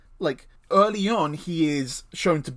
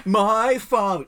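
slow drawled British My father; posh British Draco accent; 5 semitones higher, 4 dB louder, slower than usual. Shown as a demonstration. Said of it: "at least try the accent of that".

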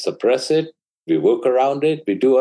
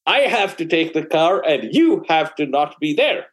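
put-on much higher, whiny exasperated teenager voice; exasperated teen son; 10 semitones higher than usual. Son demanding the car from his mother, much higher and whiny. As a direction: push it up into a frustrated adolescent whine.

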